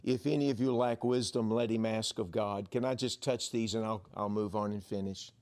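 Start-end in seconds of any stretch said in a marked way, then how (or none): none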